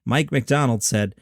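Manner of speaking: normal talking voice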